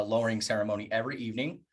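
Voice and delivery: ordinary talk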